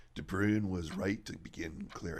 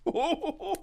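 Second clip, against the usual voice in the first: high laughing cry of Mom; laughing protest; 6 dB louder than usual. Acted. Daughter laughing at father's teasing, calling to mother.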